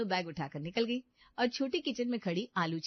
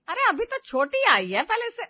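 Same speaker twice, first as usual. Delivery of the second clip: alarmed protest; 12 semitones higher, 9 dB louder than usual. Acted. Mother protests.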